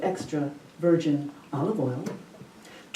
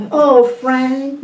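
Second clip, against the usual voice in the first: wife imitating a sweet Southern drawl; lightly put-on Southern drawl, higher and louder; 5 semitones higher, 7 dB louder than usual. Wife teasing her husband Frank, in a mock Southern drawl. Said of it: "in her version of Southern drawl".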